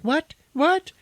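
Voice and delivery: very high, startled squeaks; high-pitched bewildered yelps; mock panic. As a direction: imitate a panicking woman. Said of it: "she's fucking panicking".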